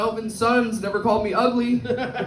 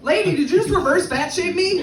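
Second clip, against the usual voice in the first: mock outrage; 7 semitones higher than usual. Indignant comeback to the woman.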